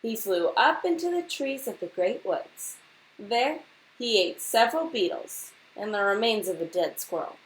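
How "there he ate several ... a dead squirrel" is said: ordinary delivery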